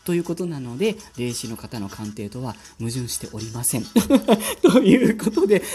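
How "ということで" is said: louder burst of laughter into speech; laughing. Real, not acted. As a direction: laugh it out sheepishly. Laughs before formal sign-off.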